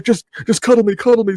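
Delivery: high, needy begging for comfort; panicky plea. Retold or imitated. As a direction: act out a shaken sleeper begging to be held. Acting plea for comfort, shaking.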